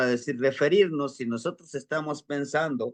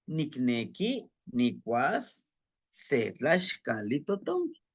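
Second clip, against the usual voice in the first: slow, word-by-word Nahuatl demonstration; slow, with pauses between word groups; slow practice reading; 4 dB quieter, slower than usual. Shown as a demonstration. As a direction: read it out slowly in phrases for learners. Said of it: "Vamos a irlo practicando".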